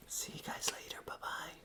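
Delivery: soft whispered goodbye; whispering. Real, not acted. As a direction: breathe the goodbye quietly.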